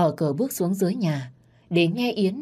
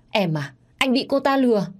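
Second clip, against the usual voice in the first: higher, louder frantic plea; desperate pleading; 7 semitones higher, 5 dB louder than usual. Acted. Cheating husband begging his wife, frantic. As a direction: beg her to believe you.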